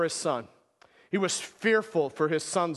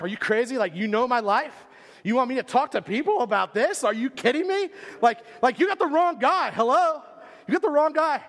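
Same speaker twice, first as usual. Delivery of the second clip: rising, louder, higher retelling of disbelief; you got the wrong guy, half laughing in disbelief; incredulous outburst; 6 semitones higher, 4 dB louder than usual. Retold or imitated. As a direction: act out shocked refusal, voice climbing. Retold disbelief at being invited.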